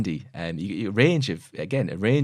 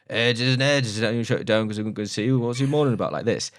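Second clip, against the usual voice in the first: imitated mumbling old farmer, pitched up; incoherent mumbling; 3 semitones higher than usual. Retelling a favourite film scene, incoherent mumbling. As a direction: run it together as garbled rural mumble. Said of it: "it says incoherent mumbling sort of thing".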